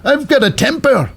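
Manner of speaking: squeaky caricature of a fiery Becky; imitating Becky Lynch. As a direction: ham up a hot-tempered Becky. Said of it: "Becky's like".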